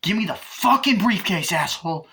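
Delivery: loud, high, forceful order; angry demand. Retold or imitated. Robber demanding the briefcase from driver, angry demand. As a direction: shout the demand with acted fury.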